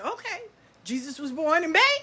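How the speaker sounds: high, baffled question; puzzled. Retold or imitated. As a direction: humor her, puzzled. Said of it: "I said".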